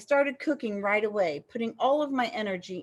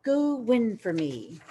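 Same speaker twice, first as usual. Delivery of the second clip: usual speaking voice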